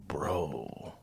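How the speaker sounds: deadpan disbelief from the welder; imitating the welder. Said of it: "He goes".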